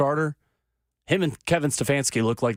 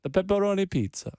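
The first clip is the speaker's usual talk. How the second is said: put-on Italian accent; 5 semitones higher than usual. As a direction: stretch it out like a cartoon Italian chef. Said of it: "one of my better accents".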